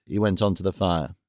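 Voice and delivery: usual speaking voice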